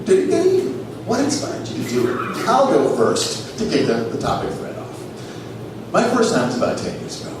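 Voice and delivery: normal talking voice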